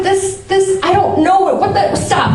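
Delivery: flustered self-talk. Retold or imitated. Comedian acts out talking to herself at the broken toilet, flustered.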